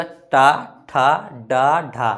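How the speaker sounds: slow, drawn-out retroflex टा ठा डा ढा; demo syllables. Shown as a demonstration. Teacher sounding out letters for students, slowly. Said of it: "टा बोलिएगा".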